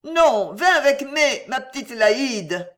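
high, loud refusal turning to wheedling; high tipsy pleading, drawn out at the end; insistent coaxing. A narrator's reading. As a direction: plead stubbornly with a friend. Drunk woman insists, coaxing her friend.

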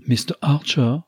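put-on a young woman calling out gaily; young cheerful cry. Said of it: "s'écria une voix jeune et gaie".

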